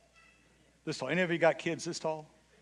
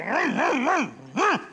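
engine vroom; 6 semitones higher, much slower than usual. Retold or imitated. Pastor mimicking his mower engine to the church, playful vroom. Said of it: "And I go".

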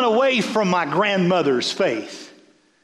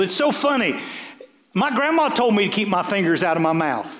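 ordinary delivery

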